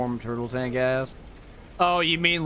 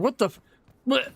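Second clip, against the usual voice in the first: higher, louder mock outcry of shock; retold shocked outburst; 11 semitones higher, 3 dB louder than usual. Retelling his dad's prank to stream chat, shocked higher outcry. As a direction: replay the gag-reflex disbelief, pitched up. Retold reaction to vodka tequila chaser.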